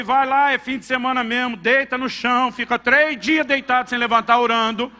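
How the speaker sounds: high and loud, slowing down at the end; stern loud refusal, drawn out at the finish; loud retold refusal. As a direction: lay down the law, loud and slow. Father refusing son money, retold.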